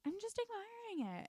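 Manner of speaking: sweet, high feigned innocence; innocent high voice. Retold or imitated. Nina caught holding Victor's raven, feigning innocence. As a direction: play dumb in a high voice.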